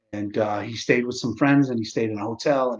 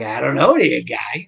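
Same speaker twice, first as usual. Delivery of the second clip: confused; 7 dB louder, slower than usual. Retold or imitated. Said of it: "I'm so confused".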